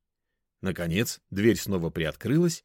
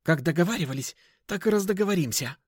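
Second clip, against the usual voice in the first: high-pitched snappish retort; snippy comeback; snapping back; 10 semitones higher, faster than usual. A narrator's reading. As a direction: snap back dismissively in a high voice. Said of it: "огрызнулось существо".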